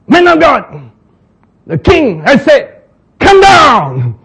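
high, loud military order; commanding captain. Acted. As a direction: bark the king's order.

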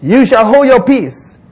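high, slow proclamation; assured leader, drawn out; confident Moses. Acted. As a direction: lay it down as a firm command.

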